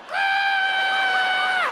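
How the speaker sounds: very high, loud panicked shriek; terrified group shriek; crowd scream. Retold or imitated. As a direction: scream like a snake just lunged. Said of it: "we were all like".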